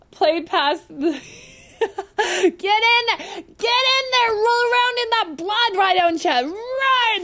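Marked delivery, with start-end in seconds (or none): 2.2-7.2 high, drawn-out exasperated cry; mock scolding shout. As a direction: egg them on in loud disbelief. Mock scolding of game character.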